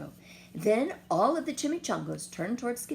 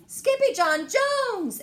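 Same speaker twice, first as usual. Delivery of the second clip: high, loud, slow call; cried out; 10 dB louder, slower than usual. Acted. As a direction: everyone cries his name. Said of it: "everyone cried".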